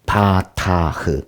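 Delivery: 바타흐 drawn out very slowly, syllable by syllable; careful Hebrew. Shown as a demonstration. Teacher modelling the Hebrew word 바타흐, slow and careful. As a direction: spell out 바타흐 slowly with a breathy final h. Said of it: "이렇게 읽고".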